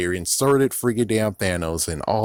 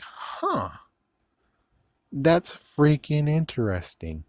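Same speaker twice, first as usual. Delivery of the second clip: high huh, then slow sarcastic musing; mock intrigue; 6 semitones higher, slower than usual. Vlogger mocking the timekeepers, slow sarcastic intrigue. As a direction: savor it slowly with fake fascination.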